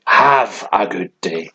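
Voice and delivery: "have a good day" with a drawn-out, weighted HAVE; "have a good day" spaced out for emphasis; emphatic. Shown as a demonstration. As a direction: lean hard on each word of "have a good day". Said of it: "emphatic, you know, like".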